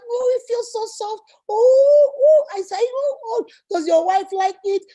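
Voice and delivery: gushing fan. Pretend. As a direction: overdo the swooning over a man. Mocking woman fawning over a beard.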